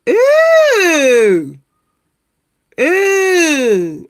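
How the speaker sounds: long, high squeal of disgust. Real, not acted. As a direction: draw it out high, grossed out. Disgusted reaction to the building.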